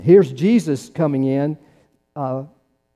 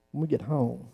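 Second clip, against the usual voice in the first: hushed, softer; 11 dB quieter than usual. Shown as a demonstration. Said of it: "repeated line, voiced softly".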